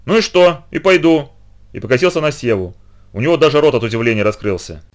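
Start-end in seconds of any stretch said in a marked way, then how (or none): 0.0-1.3 defiant call, higher; выкрик. A narrator's reading. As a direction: blurt it out defiantly. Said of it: "я крикнул". (с вызовом выкрикнуть)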